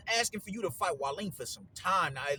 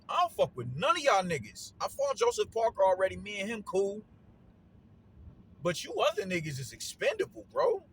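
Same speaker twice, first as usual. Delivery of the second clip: cocky boxer swagger; 6 semitones higher, 5 dB louder than usual. Retold or imitated. Voicing Joshua's attitude to rivals.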